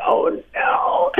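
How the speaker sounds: breathless unvoiced gasp of dread; hushed mock panic at the missed calls; panicked oh no. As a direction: gasp it like seeing bad news. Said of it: "And I was like".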